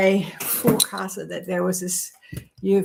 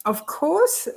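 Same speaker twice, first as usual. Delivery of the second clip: sing-song; 10 semitones higher than usual. Real, not acted. Playful aside.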